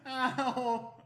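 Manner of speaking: high-pitched yelp of ow; ow after a hit. Real, not acted. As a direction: yelp from a playful smack. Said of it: "she just hit me".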